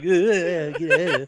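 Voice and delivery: high, loud mock disgust; laughing and pretending to shiver. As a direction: push it away while laughing. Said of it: "She's like".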